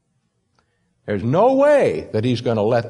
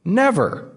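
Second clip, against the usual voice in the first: Never. lifted high and set apart; emphatic never; 8 semitones higher than usual. Shown as a demonstration. Preacher hammering home that believers never perish, emphatic. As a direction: say Never. alone, high and firm. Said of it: "That's capital N".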